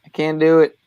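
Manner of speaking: emphatic. Real, not acted. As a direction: blurt it out with emphasis.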